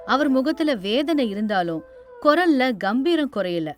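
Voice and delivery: ordinary delivery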